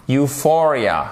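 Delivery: Euphoria opening with "you", stress on FOR; initial "you" sound. Shown as a demonstration. Teacher drilling the EU spelling, initial you sound. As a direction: clear model you-FOR-ia for Euphoria. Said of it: "prononcé comme un U".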